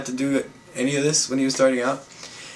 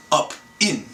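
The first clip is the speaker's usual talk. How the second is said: lightly voiced old attendant, terse order; old elevator attendant. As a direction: imitate an old man's two-word order.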